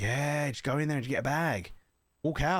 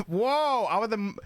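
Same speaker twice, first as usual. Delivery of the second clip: laughing; 5 semitones higher, 3 dB louder than usual. Real, not acted. Laughs at own joke.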